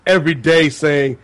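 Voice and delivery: ordinary talk, nothing marked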